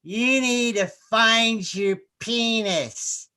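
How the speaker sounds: imitating a drunk Freudian therapist; drunken therapist. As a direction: drag the words out like a tipsy shrink. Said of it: "she goes".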